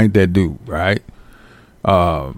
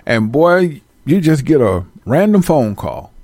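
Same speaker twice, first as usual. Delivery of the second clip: ordinary talk, nothing marked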